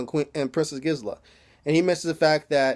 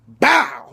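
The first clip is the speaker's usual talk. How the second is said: loud spoken impact sound; imitated whip crack; 4 semitones higher, 7 dB louder than usual. Storyteller imitating the whip strike, sudden loud burst.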